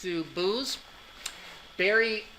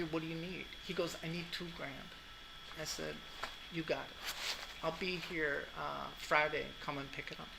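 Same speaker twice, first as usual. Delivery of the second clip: quiet retelling; 11 dB quieter than usual. Retold or imitated. Retold phone talk, very quiet.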